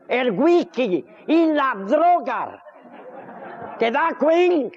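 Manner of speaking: high, slow, camp punchline; camp character voice. Acted. Comedian voicing a camp friend, slow and high.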